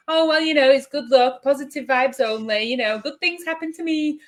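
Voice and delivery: high, bright mocking sing-song; toxic positivity mock. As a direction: parody a relentlessly upbeat person, pitched high. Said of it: "It's not".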